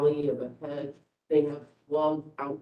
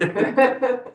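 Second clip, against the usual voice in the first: short high burst of laughter; amused laugh; 10 semitones higher, 9 dB louder than usual. Real, not acted. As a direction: laugh out at the joke. Laugh after echoing remark.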